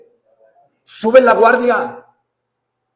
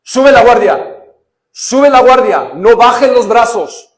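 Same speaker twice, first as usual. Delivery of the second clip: loud, slow coach's shout, higher pitched; coach yelling; 5 semitones higher, 8 dB louder, slower than usual. Retold or imitated. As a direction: bark it like a trainer at ringside. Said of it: "lo único que te gritan".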